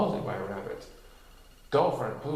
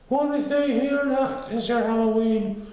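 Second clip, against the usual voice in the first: high, louder bear voice; thoughtful Pooh; 11 semitones higher, 4 dB louder than usual. A narrator's reading. Pooh inviting friends to stay under the bed, high and warm. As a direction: propose it cosily. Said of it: "said Pooh thoughtfully".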